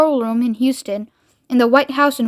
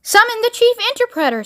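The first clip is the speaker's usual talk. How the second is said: high, parroting command; childish president; 10 semitones higher, faster than usual. A narrator's reading. President repeating Miss Tibbs's order, high childish command. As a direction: echo the order in a much higher voice. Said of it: "said the president".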